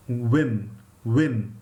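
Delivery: "win" with rounded lips, no teeth on lip; "win", lips round, no lip bite; w without biting. Shown as a demonstration. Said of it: "without biting it".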